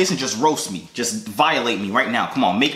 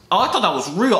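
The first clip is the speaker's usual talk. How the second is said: normal talking voice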